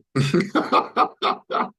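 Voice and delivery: loud, high burst of staccato laughter; hearty laugh. Real, not acted. Viewer cracking up at a comedian's joke, hearty laugh.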